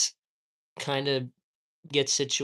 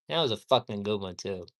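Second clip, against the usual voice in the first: ordinary talk, nothing marked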